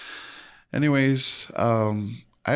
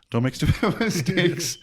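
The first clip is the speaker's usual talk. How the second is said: laughing; 10 semitones higher than usual. Real, not acted. Laughing at own lesson.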